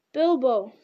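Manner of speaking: loud cry of recognition; sudden glad cry. A narrator's reading. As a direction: call his name, suddenly recognizing him. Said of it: "cried Frodo with sudden recognition".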